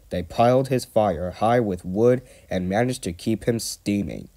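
plain reading voice

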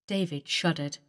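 ordinary delivery

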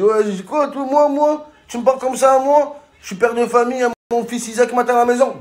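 high, heated mock outrage; angry biker. Biker confronting the narrator at the light, high and heated. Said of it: "Il me dit".

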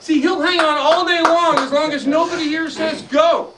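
loud, high boasting to the crowd; loud showman boast. Pretend. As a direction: dare the kids, big and bold. Showman challenging kids.

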